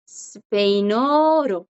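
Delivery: 스페인어로 con vocales alargadas; pronunciación pausada. Shown as a demonstration. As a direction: alarga 스페인어로 para que lo repitan. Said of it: "En español". (스페인어로 lento, de profesora)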